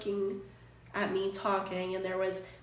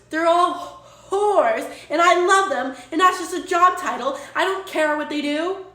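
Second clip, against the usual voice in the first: loud heated insistence; emphatic outburst; 6 semitones higher, 9 dB louder than usual. Real, not acted. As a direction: defend them at full volume. Defending friends to chat, heated.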